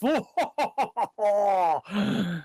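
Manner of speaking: unearthly laugh. Acted. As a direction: cackle like a ghost host. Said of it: "triumphant and unearthly laugh".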